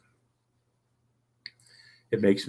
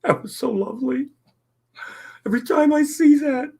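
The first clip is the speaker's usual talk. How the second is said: high tearful voice; crying; 5 dB louder than usual. Real, not acted. Streamer moved to tears by the video, high tearful voice. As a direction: sob it out, pitch way up. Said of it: "I promised I wouldn't cry again".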